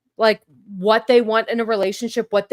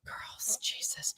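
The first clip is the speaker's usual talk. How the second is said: breathless hushed disbelief, slow; stunned murmur at the news; awed whisper; 17 dB quieter, slower than usual. Real, not acted. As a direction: whisper it like you can't believe it. Stunned reaction to five girls.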